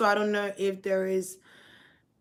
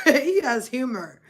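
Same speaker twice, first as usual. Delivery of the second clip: laughing; 4 semitones higher, 7 dB louder than usual. Real, not acted. Laughs at spirit's joke.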